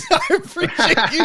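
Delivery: high-pitched thanks through laughter; real laugh breaking into the words. Real, not acted. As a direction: laugh as you thank them.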